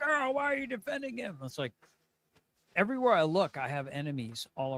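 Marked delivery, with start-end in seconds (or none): 0.0-1.4 much higher whiny quote; mocking critics. Streamer imitating critics asking why they defend him. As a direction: mimic the critics' whine. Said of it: "they're like".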